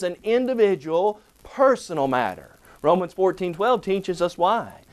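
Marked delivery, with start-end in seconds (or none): none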